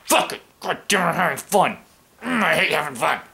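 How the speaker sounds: hammed-up sourpuss complaining; lightly voiced cranky grump; grumpy grouch. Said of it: "who just sits around going".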